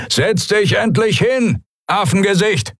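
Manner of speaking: a guard hopped up on drugs, barking orders; drugged-up pirate. Acted. Guard to captive, high jittery drug-fuelled jeer. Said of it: "seine Stimme von Silberfeuer oder einer anderen, nicht ganz so legalen Droge aufgeputscht".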